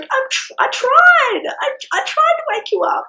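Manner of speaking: very high, loud self-defence; frantic mock protest. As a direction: squeal it back, acting desperate and exasperated. Acting frantic argument with partner.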